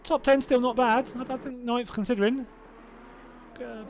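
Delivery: ordinary talk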